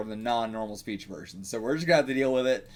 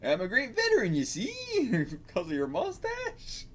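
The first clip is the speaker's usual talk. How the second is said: higher, put-on bumpkin accent; country bumpkin accent; 8 semitones higher than usual. Beggar boasting of being a veteran, country bumpkin accent. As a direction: play a country bumpkin with raised pitch. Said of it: "kinda like country bumpkin accents".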